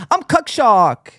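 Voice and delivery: high, slow, hammed-up Chinese accent; a crude put-on Chinese accent, drawn out; mock Chinese accent. Joking to stream viewers in a slow, hammed-up Chinese accent. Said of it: "it's fitting to do a Chinese accent".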